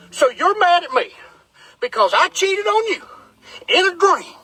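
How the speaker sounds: high-pitched mock disbelief; retold baffled questioning, climbing high; incredulous question. Acting his disbelief to wife.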